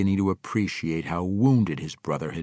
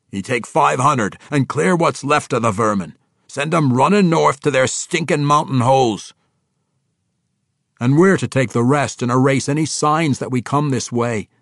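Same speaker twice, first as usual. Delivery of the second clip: high, loud brogue giving orders; commanding dwarf king; 6 semitones higher, 8 dB louder than usual. Acted. Dwarf king sending troops into the keep, loud and commanding.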